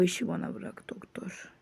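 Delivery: unvoiced conspiratorial whisper. Telling the doctor to leave it to him, whispered. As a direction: let the doctor in on it quietly.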